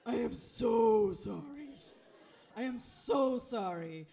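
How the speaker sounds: loud, slow "I am so sorry"; mortified apology. Retold or imitated. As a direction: drag out the apology, loud and stricken. Flight attendant apologizing, mortified.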